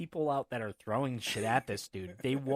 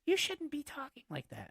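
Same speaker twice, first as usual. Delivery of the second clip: much higher, scolding mock voice; scolding lady; 14 semitones higher, 3 dB quieter than usual. House mom scolding the heckler, high mock voice. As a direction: mimic a prim house mom. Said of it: "she's like".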